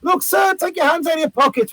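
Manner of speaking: high-pitched street-performer call; mock-scold an audience member from afar. Calling out to the crowd.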